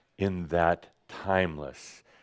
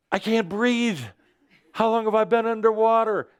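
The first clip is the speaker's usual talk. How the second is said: high, panicky cry, acted; mock screaming out; 12 semitones higher, 4 dB louder than usual. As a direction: play the human in sudden alarm, much higher. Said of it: "It's the human screaming out".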